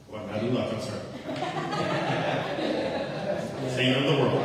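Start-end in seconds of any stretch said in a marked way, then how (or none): 1.1-3.7 long high laugh; real drawn-out laughter; amused laugh. Real, not acted. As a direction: laugh at your own slip of the tongue. Laughs at his own slip.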